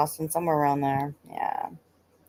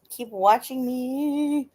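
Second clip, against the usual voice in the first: slow teasing; 5 semitones higher, much slower than usual. Real, not acted. Teasing viewers who watch.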